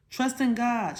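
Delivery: read-aloud mock shout; mild shout. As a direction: call it out a little, like kids answering. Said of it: "shouted the triplets".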